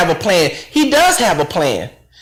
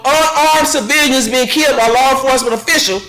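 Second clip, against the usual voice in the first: usual speaking voice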